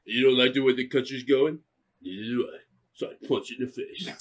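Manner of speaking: a lightly voiced Rocky mumble; Sly Stallone voice. Stallone stumping for president, low mock mumble. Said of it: "I'm Sly Stallone".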